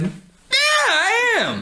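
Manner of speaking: high-pitched mocking shout; exaggerated squealed admission; mock fursuiter voice. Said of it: "the tone of voice that he has".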